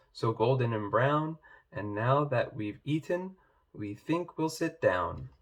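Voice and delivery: ordinary delivery, nothing marked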